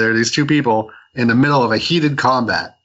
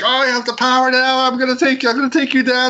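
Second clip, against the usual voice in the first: very high-pitched manic rant; mock freak-out; 12 semitones higher than usual. Agent shot full of heroin by mistake, high mock freak-out. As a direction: rattle out wild threats in a squealing high voice. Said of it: "like, freaking out".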